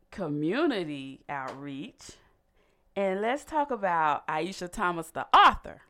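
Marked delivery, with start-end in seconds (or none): 2.9-5.8 high, loud hype on the author; excited. Real, not acted. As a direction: announce it like big news. Hyping guest as author.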